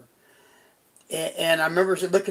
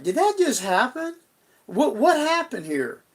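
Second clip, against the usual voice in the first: higher pitched, startled questions; stunned disbelief; 9 semitones higher than usual. Retold or imitated. Waking from a vision of Debbie, stunned and asking himself. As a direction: ask it like you can't believe it.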